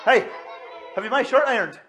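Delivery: high, loud nagging questions; demanding family voices. Shown as a demonstration. Minister voices family pestering mummy, high and loud. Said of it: "mummies get these sort of things".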